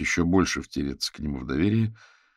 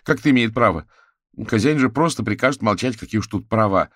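higher, louder heated objection; indignant; 7 semitones higher, 8 dB louder than usual. A narrator's reading. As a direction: protest hotly at the very idea. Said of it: "Кузя возмущённо фыркнул".

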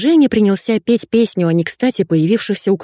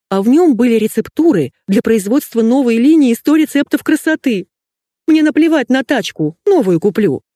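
higher-pitched, agitated rant; distraught wailing; 6 semitones higher, 3 dB louder than usual. A narrator's reading. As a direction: wail about the loss, voice raised high. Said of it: "вопила Светка". (взволнованно, высоким голосом причитая)

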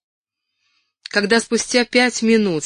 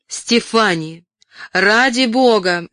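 exasperated plea, louder and drawn out; restrained exasperation; 4 semitones higher, 6 dB louder, slower than usual. A narrator's reading. Ellen to Stephanie who took her photo, holding back a shout. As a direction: barely keep from shouting. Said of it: "с трудом удерживалась, чтобы не закричать".

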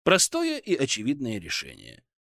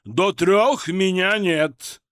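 slow, loud irritated yell; irritated shout; 5 semitones higher, 6 dB louder, much slower than usual. A narrator's reading. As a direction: bellow it from behind a door. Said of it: "раздражённо ответил из-за двери сочный баритон".

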